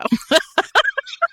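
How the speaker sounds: sudden high laugh; cracking up at the joke; high laughing burst; high burst of laughter. Real, not acted. Laughs at her own retelling.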